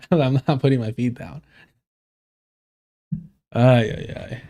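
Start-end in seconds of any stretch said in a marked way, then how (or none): none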